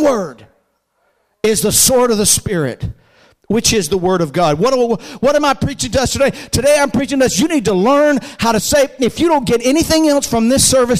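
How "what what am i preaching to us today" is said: normal talking voice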